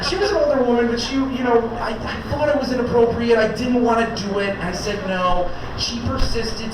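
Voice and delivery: ordinary talk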